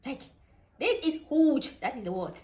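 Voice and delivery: ordinary talk, nothing marked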